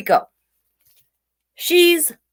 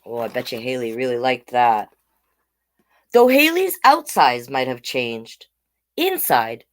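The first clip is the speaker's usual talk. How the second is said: usual speaking voice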